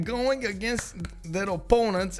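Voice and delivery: normal talking voice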